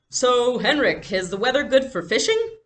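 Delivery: hearty hello down the phone, putting on a carefree front; breezy loud phone cheer; cheerfully. A narrator's reading. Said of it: "Papa asked cheerfully".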